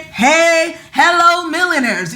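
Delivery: light singsong greeting, drawn-out hey; signature greeting. Retold or imitated. Host hailing the millionaire audience, lightly mimicked greeting. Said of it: "the way that you say".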